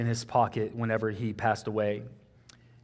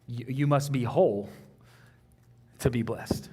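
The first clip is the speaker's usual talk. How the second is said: normal talking voice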